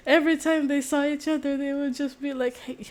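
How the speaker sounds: can barely keep it together; laughing. Real, not acted. Vlogger cracking up while explaining the skaters' rivalry. Giggling through the retelling.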